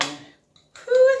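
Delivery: sharp high whew over hot food; hot blow. Real, not acted. Blowing on a hot soup bowl.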